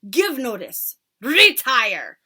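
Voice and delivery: loud, high witch commanding; Grand High Witch. Acted. Witch leader commanding her followers, loud and harsh. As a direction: bark orders like a tyrant witch.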